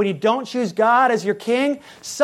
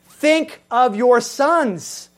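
very slow, louder, "Think" drawn out; solemn warning; 4 semitones higher, 4 dB louder, much slower than usual. Acted. Preacher voicing God warning Israel about their sons. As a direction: lay each word down as a warning.

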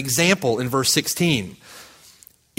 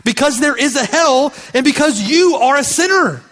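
building preaching; 7 semitones higher, 4 dB louder than usual. Real, not acted. Preacher building a list of reasons.

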